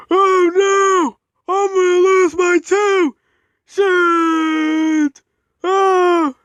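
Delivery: high, loud terrified yelling; panicked scream. Acted. Edward screaming in fear of crashing, panicked scream. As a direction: shriek as if about to crash.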